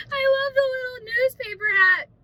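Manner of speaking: high, loud, teary gush; half crying with delight over the little hat. Real, not acted. Gushing over baby clothes.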